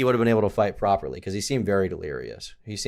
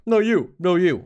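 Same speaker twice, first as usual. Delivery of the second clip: high, louder silly retort; childish taunting comeback; 9 semitones higher, 5 dB louder, slower than usual. Retold or imitated. Trolling the girlfriend because it's funny, childish taunt. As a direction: throw it back like a playground taunt.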